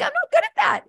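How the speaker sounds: high, airy "good" sung up then dropped; breezy self-acceptance, voice jumping high; owning it. Shown as a demonstration. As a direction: shrug it off brightly, like a cheerful confession. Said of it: "and own it".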